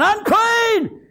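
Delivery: very high, drawn-out cry; hollered warning. Acted. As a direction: holler it as lepers warning off passers-by. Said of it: "they're hollering".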